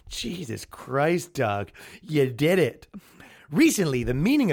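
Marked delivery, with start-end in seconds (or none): 0.1-2.9 weary disbelief. Retold or imitated. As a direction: sigh it out, defeated and impressed. Karen seeing the meme everywhere.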